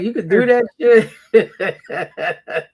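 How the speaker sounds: slow, loud, high, laughing disbelief; laughing while asking in shock; amazed disbelief. Retold or imitated. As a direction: stretch it out in laughing astonishment. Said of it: "I was like".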